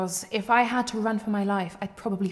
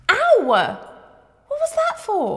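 sharp high yelp then indignant; sudden pain; 11 semitones higher, 6 dB louder, much slower than usual. Acted. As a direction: cry out as if struck. Just got hit.

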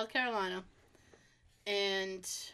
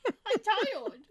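high, slow whine of exhaustion; whiny tired voice; 10 semitones higher than usual. Retold or imitated. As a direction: whine that you're too tired. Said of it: "and I'm like".

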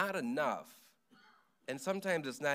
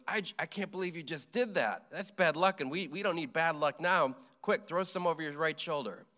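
lightly acted panicky scolding, pitched up and louder; mock alarm at a friend's blunder; agitated Lloyd; 5 semitones higher, 5 dB louder than usual. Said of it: "And Lloyd goes".

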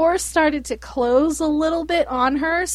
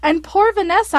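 ordinary talk

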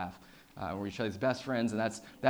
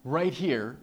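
higher and louder, building a reveal; big reveal; 7 semitones higher, 7 dB louder, slower than usual. Real, not acted. Showing the audience the helmet, excited reveal. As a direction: present it with a flourish.

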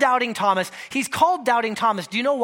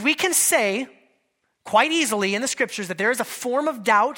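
ordinary talk

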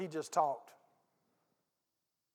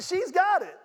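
excited; 10 semitones higher, 5 dB louder than usual. Real, not acted. Pastor cheering the lady's insight.

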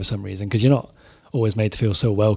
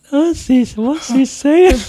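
confused panic; 8 dB louder, much slower than usual. Retold or imitated. Band confused by drummer's shout.

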